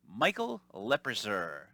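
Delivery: "Leprazer" said slowly; mispronounced name. Retold or imitated. Telemarketer slowly mangles the name "Leprazer". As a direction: drag out "Leprazer". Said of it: "And she goes".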